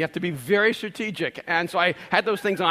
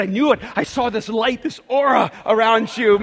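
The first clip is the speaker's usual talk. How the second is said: imitated excitement; 6 semitones higher, 8 dB louder than usual. Excited stranger claims a vision.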